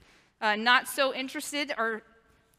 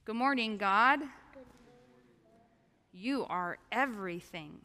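sweet patient sunday school; 6 dB quieter than usual. Shown as a demonstration. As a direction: lead the kids in prayer, gently. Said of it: "repeat after me".

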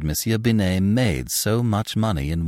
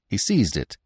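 ordinary delivery

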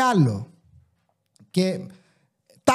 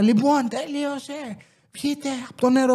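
mimicking the mother; 6 semitones higher, 5 dB quieter than usual. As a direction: impersonate the fed-up mother calling her boys out, stretching each word. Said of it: "και έλεγε πρώτα στα δύο τα αγοράκια". (αργή, τραβηγμένη μίμηση της μάνας που διατάζει)

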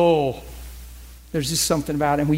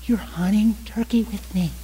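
awed whisper; 3 semitones higher than usual. Retold or imitated. Amazed aside to God.

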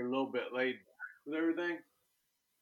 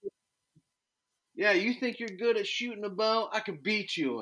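much higher, mocking taunt; taunting challenger; 10 semitones higher, 4 dB louder than usual. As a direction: needle him like a smug rival. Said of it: "I've had millions of people go".

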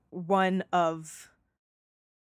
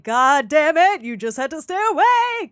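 higher, louder mock-frustrated shout at the screen; exasperated yell; 6 semitones higher, 7 dB louder than usual. Viewer scolds the TV when Nadia wakes alone, loud exasperated shout. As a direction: yell at a character who fell asleep. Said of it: "and I was like".

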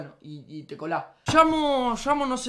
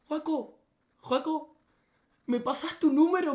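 put-on shy girl voice; 5 semitones higher than usual. Girl asks for his number.

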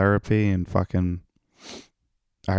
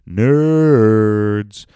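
loud mock shout; 3 semitones higher, 11 dB louder than usual. Loud opening exclamation.

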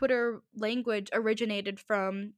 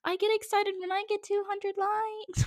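mock incredulous self; 9 semitones higher than usual. Comparing her likes to theirs, high and incredulous. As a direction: squeak it out in disbelief. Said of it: "And I'm just like".

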